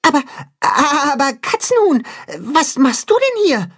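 put-on high, baffled young goat; puzzled. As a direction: ask as if caught completely off guard. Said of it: "fragt Tacho verdutzt".

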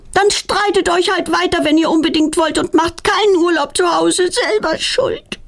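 put-on high, sulky child's grumble, slowing at the end; grumbling off. A narrator's reading. Girl grumbling at her parents while leaving, high and sulky. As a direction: complain up high as she stomps off. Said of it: "verschwindet meckernd nach oben".

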